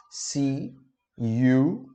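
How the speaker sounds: slow, drawn-out model; slow model phrase. Shown as a demonstration. Teacher modeling "see you tomorrow" slowly for students. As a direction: stretch each word of "See you tomorrow". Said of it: "Vejo você amanhã".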